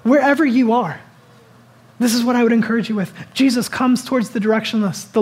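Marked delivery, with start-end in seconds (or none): none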